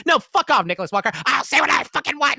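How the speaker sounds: high, loud jokey yell back; fake-angry clapback; mock outburst. Answering a TikTok kid, mock angry yell. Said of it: "I was like".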